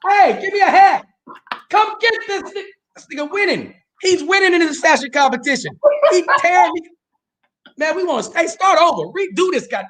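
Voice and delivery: hammed-up jealous rant about the shirt; mock outrage. Mock outrage at host's outfit.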